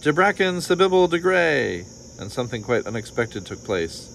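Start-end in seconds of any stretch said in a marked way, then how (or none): 0.0-1.9 high, sustained incantation falling at the end; ringing, mounting magic chant; intoned. A narrator's reading. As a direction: cast the spell with full ceremony. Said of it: "the bone intoned".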